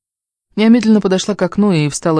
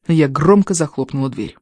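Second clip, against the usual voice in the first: ordinary delivery, nothing marked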